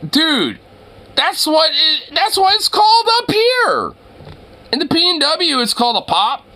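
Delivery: very high, squealed protest easing toward the end; incredulous protest. Real, not acted. Streamer defending the word pop in the P N W, incredulous protest. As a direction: exclaim it in disbelief, voice shooting up.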